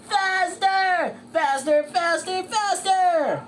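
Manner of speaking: high, frantic repeated yell; shouting coach. Acted. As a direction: push the racer harder with every word. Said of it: "shouted his coach".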